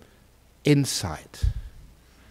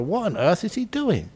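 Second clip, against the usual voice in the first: puzzled donkey; 3 semitones higher, 8 dB quieter than usual. Acted. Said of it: "the donkey first wondered".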